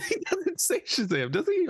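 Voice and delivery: host questions himself in a high squeak; squeaky disbelief. Real, not acted. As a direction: voice jumps way up in doubt.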